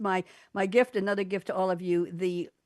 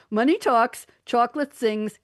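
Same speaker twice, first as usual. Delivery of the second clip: louder punchline; 5 semitones higher, 7 dB louder than usual. Shown as a demonstration. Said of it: "Now I'm gonna cry".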